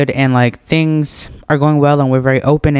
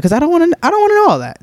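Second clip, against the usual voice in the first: rushed protest; much faster than usual. Real, not acted. Refusing to hear about exes.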